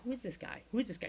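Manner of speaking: imitating hushed murmurs in the crowd; soft, curious aside, lightly acted; puzzled onlookers. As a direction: quote onlookers wondering under their breath.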